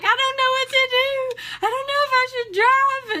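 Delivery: very high, wailing panic; hammed-up tearful wail; mock crying. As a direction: imitate bawling down the phone, overwhelmed. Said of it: "I just start crying".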